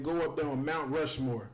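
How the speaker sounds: normal talking voice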